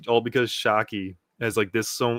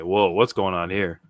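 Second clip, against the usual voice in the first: ordinary delivery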